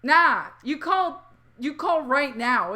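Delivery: slow, deliberate fatherly order; dad ordering his daughter, slow and firm. Retold or imitated. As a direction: lay down the law slowly. Dad urging her to call.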